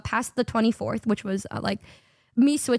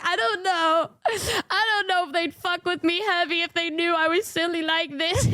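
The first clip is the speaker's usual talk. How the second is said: higher, whiny put-on fretting; mock whiny worry; 4 semitones higher than usual. Answering roommate's tease, in a mock whiny voice. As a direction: whimper it like a worried kid. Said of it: "And I'm like".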